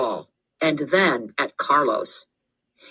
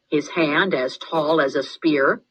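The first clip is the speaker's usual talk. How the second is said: usual speaking voice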